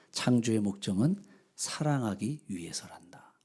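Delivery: slow, hushed whisper; whispered answer. A narrator's reading. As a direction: breathe the answer slowly and tenderly. God's gentle answer.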